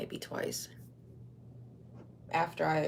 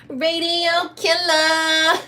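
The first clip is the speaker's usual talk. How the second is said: high, loud, slow call-out; hype announcement; 9 semitones higher, 8 dB louder, slower than usual. Acted. Streamer shouts a name, hyped.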